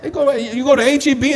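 high, loud, fast mocking scold; preaching at full force. Preacher teasing members who skip church for rain, high loud scold. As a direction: rib the no-shows hard.

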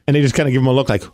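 usual speaking voice

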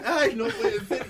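mock tearful disbelief. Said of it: "y yo de".

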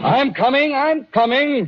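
calling out. Acted. Answering police banging at door.

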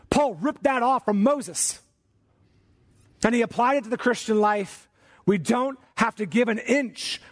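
high-pitched, slow, emphatic sermon delivery; preacher rallying the congregation to hold firm; impassioned preaching. Real, not acted. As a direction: drive each phrase home with weighted pauses. Preaches the command at raised pitch.

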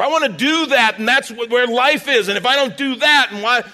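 high, loud protest; loud whining demand. Retold or imitated. Preacher voicing the flesh arguing with Jesus, loud and whiny. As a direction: insist like a stubborn child.

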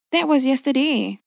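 snapped retort. A narrator's reading. Wife snapping at her husband, sharp and high. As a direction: bite back the words. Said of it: "Ma snapped".

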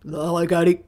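put-on grown man's voice, dropped from the kid's squeak; mock full man's voice. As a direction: greet like a boy whose voice has broken. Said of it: "woke up with a man's voice".

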